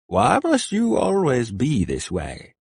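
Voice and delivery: slow, tired, sighing plea; an old friend worn out by the same fight; weary old man. A narrator's reading. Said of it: "Burkhard asked with a weary sigh".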